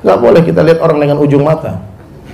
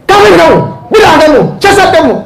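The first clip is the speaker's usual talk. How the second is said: jab each accusation like a harsh preacher; angry accusing preacher; 10 semitones higher than usual. Retold or imitated. Preacher mocking harsh preachers who call everyone kafir, angry shout. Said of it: "Tiap ngomong marah".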